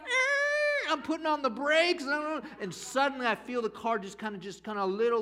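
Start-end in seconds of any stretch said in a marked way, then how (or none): 0.0-0.9 very high startled shriek; panicked yelp. Retold or imitated. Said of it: "I'm like".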